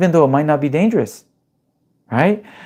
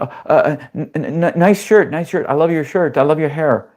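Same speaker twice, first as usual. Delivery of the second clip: nervous stammering; 3 semitones higher than usual. Retold or imitated. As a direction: stammer out the compliments in a panic. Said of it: "And then I'm like".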